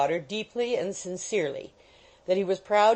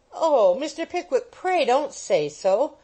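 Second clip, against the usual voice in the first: crying out in dismay; 6 semitones higher, 4 dB louder than usual. Acted. The girls beg Pickwick to take it back, high dismayed plea. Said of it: "cried Emily and Arabella".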